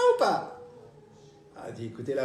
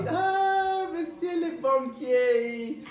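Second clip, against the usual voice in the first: put-on old lady sing-song; 12 semitones higher, much slower than usual.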